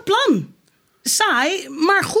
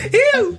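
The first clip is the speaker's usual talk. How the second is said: mock wail; 9 semitones higher, 3 dB louder than usual. Sap imitating the panic wail.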